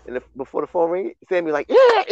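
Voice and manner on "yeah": sudden high loud whoop; excited yeah. Retold or imitated. Sami thrilled before the phone rang, loud high whoop. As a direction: burst out with joy. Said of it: "And so he was excited".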